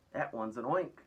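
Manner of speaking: half-hearted scream of alarm; mock scream. A narrator's reading. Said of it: "screamed the head of the fire department".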